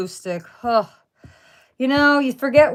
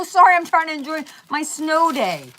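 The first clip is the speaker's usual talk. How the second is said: sarcastic; 8 semitones higher, 4 dB louder than usual. Retold or imitated. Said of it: "I go".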